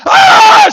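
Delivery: piercing, very high scream; full-force acted scream. Husband turning down the vertical drop, screaming. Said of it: "and I scream".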